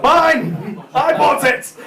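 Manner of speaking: defiant, fed-up retort; huffy retort. Retold or imitated. Mate gives up on the wasp, huffily. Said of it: "mate's huffy retort".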